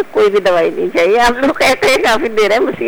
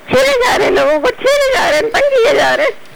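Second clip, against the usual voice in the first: much higher, whiny teasing complaint; mock whine. Caller teasing the hosts with a high mock whine. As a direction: pitch up into a playful whimper.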